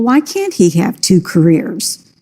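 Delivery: normal talking voice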